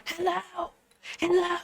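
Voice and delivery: high, hollow calling hello; imitating the stranger. Retelling the show's opening, mock stranger calling hello. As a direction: call out like the man in the sack. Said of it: "This guy walks in".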